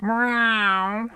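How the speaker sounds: long, high mock meow; cat meow. Streamer greeting Medley the Cat, playful meow. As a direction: imitate a cat with a drawn-out meow. Imitates the cat just named.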